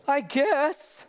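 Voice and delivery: put-on high, laughing shrug of a kid; a boy's high unimpressed reply. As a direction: toss it off like a bored boy. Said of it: "And he goes".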